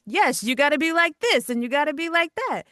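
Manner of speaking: mock announcer, high and loud; old-style voiceover. Shown as a demonstration. Voice actor showing how voiceover used to be, high projected announcer. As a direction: push it up and out like a dated commercial read. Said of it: "voiceover in the past used to be".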